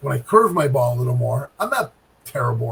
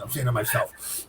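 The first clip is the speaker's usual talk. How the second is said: ordinary talk, nothing marked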